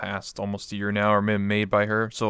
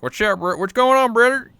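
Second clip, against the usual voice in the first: put-on Southern hillbilly accent; 11 semitones higher, 8 dB louder than usual. Streamer showing her old gaming voice, hammed-up Southern hillbilly accent. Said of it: "a real South".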